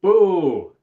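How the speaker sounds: loud, high booing. Real, not acted. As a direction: boo it out loud. Booing the magic rules.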